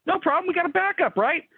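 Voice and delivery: high, breezy imitation of naive confidence; mock confident. Mocking own earlier overconfidence.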